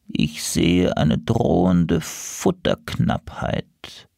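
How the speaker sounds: very slow, low prophecy; seer crow. Acted. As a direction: intone it like an oracle in a trance. Seer crow foretells danger.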